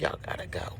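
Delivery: hushed, breathy get out; whispered urge. Retold or imitated. Mother quietly shoos ghost kids out, whispered. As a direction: whisper them out the door.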